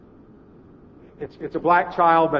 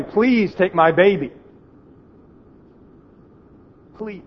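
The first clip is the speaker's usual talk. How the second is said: louder, slow, drawn-out desperate cry; screaming plea; 5 semitones higher, 8 dB louder, slower than usual. Retold or imitated. Mother chases the plane screaming after him, loud desperate plea. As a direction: call after the plane, begging with everything. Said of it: "screaming all the way".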